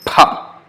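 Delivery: "Pa" as one breathy burst, no vowel between; aspirated pa. Shown as a demonstration. Teacher demonstrating aspiration, one breathy "Pa". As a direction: model "Pa" with a whispered puff. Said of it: "not paha".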